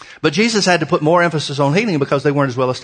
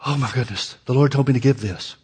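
mock fretting; 3 semitones lower, 4 dB quieter than usual. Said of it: "people get all buggy".

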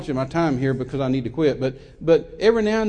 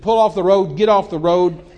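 loud, raised-pitch demand; retold barked order; 3 semitones higher, 8 dB louder than usual. Gunman to the driver, retold loud impatient order. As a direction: imitate a fed-up gunman's order.

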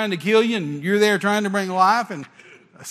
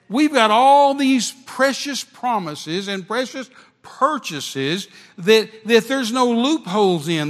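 preaching; 5 semitones higher, 4 dB louder than usual. Acted. Preaching with rising fervor.

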